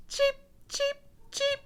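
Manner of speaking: very high chirping peeps; newly hatched chicks calling cheep; baby bird cheeps. Acted.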